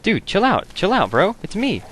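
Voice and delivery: high, whiny plea to chill out; high pleading protest. Real, not acted. Gamer whining high at an angry NPC guard. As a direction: squeak it's me at the guard.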